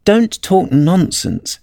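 high, slow, mildly bold retort; bolder. A narrator's reading. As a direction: answer back with a touch of boldness. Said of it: "said Alice more boldly".

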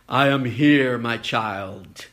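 a light booming heavenly voice; God's voice. Acted. God's voice in the joke.

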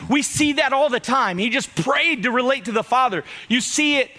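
ordinary delivery

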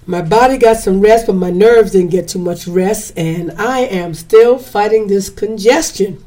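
usual speaking voice

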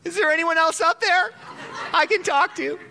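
high, loud desperate plea with a laugh; panicked punchline. Acted. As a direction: blurt it out, terrified and comic. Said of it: "And Freddie says".

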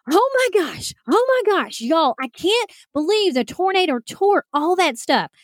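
high-pitched mock panicked yelling; retold freak-out. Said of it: "I start freaking out and yelling".

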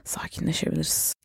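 whispered, calming herself down. Real, not acted. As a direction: breathe out and whisper it to calm down. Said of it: "özür dilerim gerildiyseniz".

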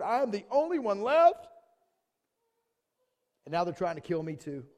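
high, loud preaching read; loud impassioned Bible reading; forceful scripture reading. A narrator's reading. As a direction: proclaim the verse at full volume. Preacher reads scripture at full force.

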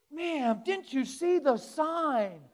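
loud, high, drawn-out mock scolding. Preacher plays annoyed driver scolding ma'am, ticked off. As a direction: scold with exaggerated indignation.

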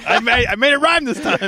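proud of the pun, giggling; laughing. Real, not acted. Laughing at own Maybelline pun.

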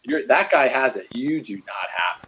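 a low, hint-of-scorn put-down; lightly voiced accuser crushing your confidence; enemy's discouraging voice. Imagined enemy telling a believer to quit, low and scornful. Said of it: "only the enemy talks like that".

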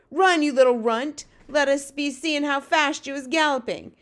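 loud, high bullying giant shout; shouted taunt. Acted. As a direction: jeer at him loudly and send him running. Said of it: "and shouted".

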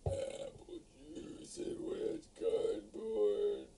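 breathy high whisper, slow. Pretend. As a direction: whisper it like a snooty wine critic. Mock wine critic whisper.

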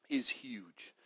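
whispered in awe; awed whisper. Real, not acted. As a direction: breathe it out in wonder. Awe at God's greatness.

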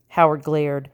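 plain reading voice

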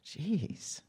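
ordinary delivery

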